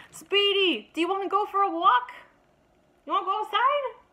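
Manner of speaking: high, loud, bouncy invitation; excited dog talk. Real, not acted. Owner asking her dog to go for a walk, high and excited.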